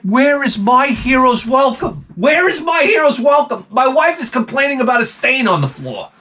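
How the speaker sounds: mock outraged husband wanting credit; indignant complaint. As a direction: whine out the injustice, way up high. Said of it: "I started to get so angry".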